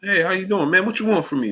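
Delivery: high mock hello, then suspicious comeback; stranger greeting and wary reply. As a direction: play both sides, cheery then guarded. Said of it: "You don't even know how to respond".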